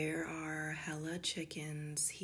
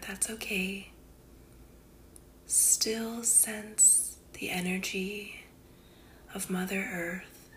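guided meditation; 3 semitones higher, 3 dB louder than usual. Acted. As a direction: guide the listener unhurriedly into calm, phrase by phrase.

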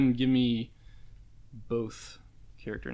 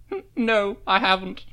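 character voice; 8 semitones higher, 4 dB louder than usual. Acted. Character quote in guessing game.

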